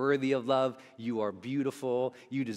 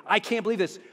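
mock anger; 9 semitones higher, 6 dB louder than usual. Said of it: "So angry".

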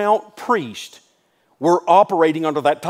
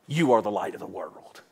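hushed; 10 semitones lower, 9 dB quieter than usual. Acted. Preacher drops to hush.

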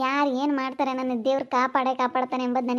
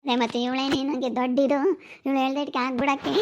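usual speaking voice

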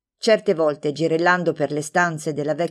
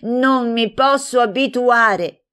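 slow, louder, higher despairing lament; 6 semitones higher, 4 dB louder, slower than usual. Acted. Aunt laments her niece's cut hair, slow and louder. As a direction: drag it out in dismay. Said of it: "borbottò allontanandosi in fretta".

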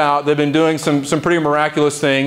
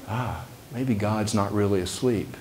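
hushed, soft; 5 semitones lower, 9 dB quieter than usual. Acted. Preacher softly reassuring the congregation, hushed. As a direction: drop to a near hush. Gentle realisation in sermon.

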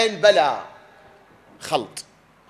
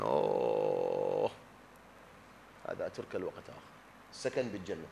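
ordinary delivery

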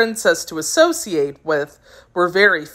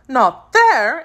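higher, questioning echo of bad news; surprised exclamation; 7 semitones higher, 3 dB louder than usual. A narrator's reading. Princess hearing the piglet is missing, surprised exclamation. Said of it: "exclaimed Ozma".

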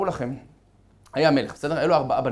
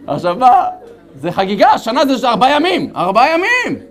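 mock panic; 7 semitones higher, 10 dB louder than usual. Mock panic over guests.

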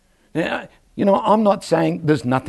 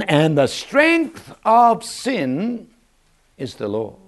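slow scripture emphasis; 3 semitones higher, much slower than usual. Acted. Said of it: "reading scripture with emphasis".

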